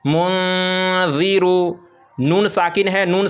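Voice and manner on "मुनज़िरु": munziru with noon held in the nose; slow chanted munziru, long nasal hum; ikhfa on the noon. Shown as a demonstration. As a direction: linger on the hidden n of munziru. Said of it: "इसी तरह".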